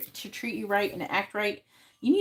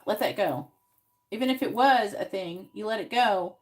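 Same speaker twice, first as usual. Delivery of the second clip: normal talking voice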